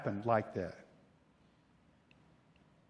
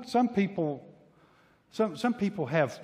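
ordinary delivery

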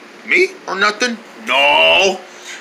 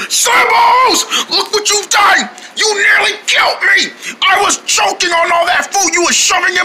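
angry yelling; 8 semitones higher than usual. Acted. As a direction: scold him for nearly killing. Angry rant after choking.

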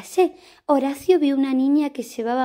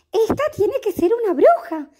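excited thought; 9 semitones higher, 4 dB louder than usual. Acted. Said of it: "pensó".

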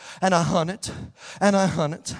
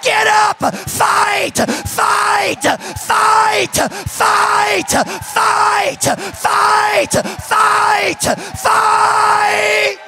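high-pitched revival shout with long-held words; fervent shouted preaching; 9 dB louder, slower than usual. Real, not acted. Preacher rallying church to fight the enemy, high shouted preaching. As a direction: drive each phrase home like a battle cry.

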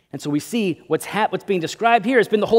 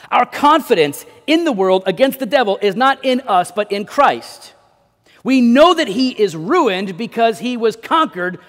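raised, emphatic pulpit voice; forceful preaching; 5 semitones higher, 5 dB louder than usual. Acted. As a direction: proclaim it loudly to the congregation.

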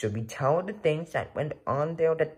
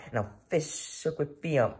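ordinary delivery

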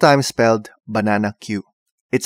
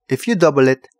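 ordinary delivery

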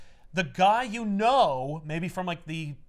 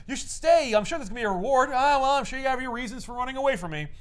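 high, hammed-up old man; mock old security guard; 7 semitones higher, 4 dB louder than usual. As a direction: lift the voice up for a kindly old guard. Old guard voiced in recap.

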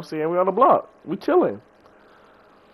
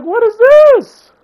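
slow, drawn-out squeal, much higher and louder; stunned disbelief; 12 dB louder, much slower than usual. Retold or imitated. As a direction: stretch it out in shocked disbelief. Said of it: "I'm like".

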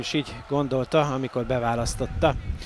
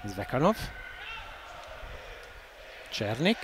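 ordinary talk, nothing marked

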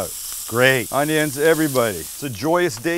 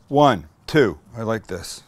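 usual speaking voice

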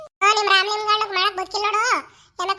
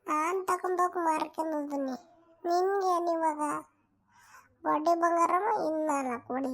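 taunting; 5 semitones lower, 5 dB quieter, slower than usual. Acted. Taunting rival in a low voice.

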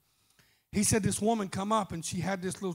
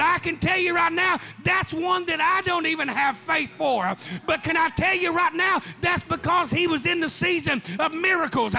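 raised, rhythmic sermon cry; full-force preaching; 7 semitones higher, 5 dB louder than usual. Acted. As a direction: preach it hard over the music.

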